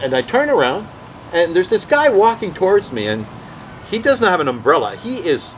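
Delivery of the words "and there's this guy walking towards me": usual speaking voice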